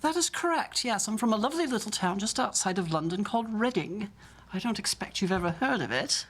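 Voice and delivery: posh West End English; Jamie Saint Andrews. Acted. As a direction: chat politely in a posh West End accent. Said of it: "my posh West End accent".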